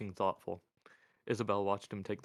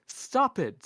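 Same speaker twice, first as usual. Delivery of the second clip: high, loud female-character cry; urgent, raised-voice plea to stop; alarmed intervention; 11 dB louder than usual. A narrator's reading. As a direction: cut in sharply at high pitch. Isabel intervenes in the threat.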